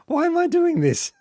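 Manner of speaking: squeaky despairing question; high exasperated wail. Real, not acted. Frustrated at game.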